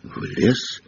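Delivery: breathless frightened whisper; shaken whisper. A narrator's reading. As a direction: lose all the swagger. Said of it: "голос у него был совсем не такой самоуверенный".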